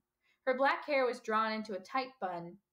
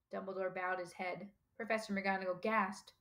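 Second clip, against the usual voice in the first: ordinary delivery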